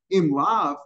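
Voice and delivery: normal talking voice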